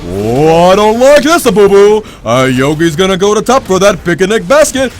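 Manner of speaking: swooping cartoon bear voice, rising at the end; exaggerated sing-song bear impersonation; Yogi Bear. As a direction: play a hammy cartoon bear after a picnic basket. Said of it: "that like that Yogi Bear impersonation".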